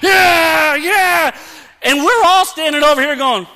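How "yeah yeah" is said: huge, high, drawn-out victory shouts; wrestling champion yell. Retold or imitated. Mocking the proud pig killer, wrestling champion's shouts. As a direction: roar like a hammed-up wrestler who just won. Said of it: "like the W W F champion".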